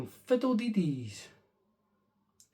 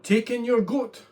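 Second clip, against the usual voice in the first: higher, louder confident advice; wise old man; 7 semitones higher, 8 dB louder than usual. Acted. Wise old man telling the lady to take her hen. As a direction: announce the plan brightly.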